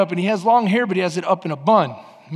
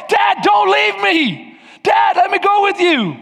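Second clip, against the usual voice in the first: put-on high, loud, slow child's plea; child crying out to dad; pleading child; 11 semitones higher, 4 dB louder than usual. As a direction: beg like a kid chasing his father. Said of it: "like that kid that wants to go with dad".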